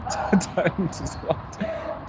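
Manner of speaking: words squeezed out through breathless laughter; laughing in disbelief. Real, not acted. Streamer reacting to being guessed Thai, laughing in disbelief. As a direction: crack up while saying it, drawn out. Amused at viewers guessing Thailand.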